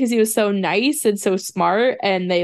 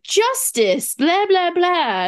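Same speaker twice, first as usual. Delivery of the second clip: high, preachy blah blah; mock a lecture on justice; blah blah mimicry; 9 semitones higher, much slower than usual. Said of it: "Sino started being like".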